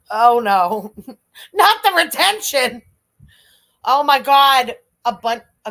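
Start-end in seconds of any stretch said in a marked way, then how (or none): 0.1-2.8 laughing shock. Real, not acted. Host reacting to a chat message, laughing in shock. 3.9-4.8 excited. Real, not acted. Reacting to a shocking donation message.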